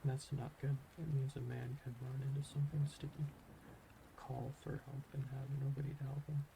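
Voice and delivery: usual speaking voice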